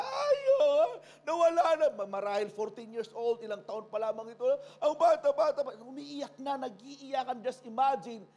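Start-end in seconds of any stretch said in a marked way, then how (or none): 0.0-1.9 high, loud grieving cry; acted wailing. Mourners at Jairus' house, loud high lament. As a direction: wail that she is gone.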